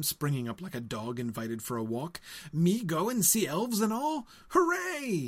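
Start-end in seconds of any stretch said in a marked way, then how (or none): 2.5-5.3 high, loud, bursting with joy; excited shout. Acted. Sam learns he'll see elves, a joyful shout. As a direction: cheer it out, voice leaping way up on Hooray. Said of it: "he shouted".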